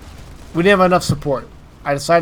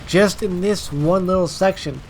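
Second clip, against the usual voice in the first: usual speaking voice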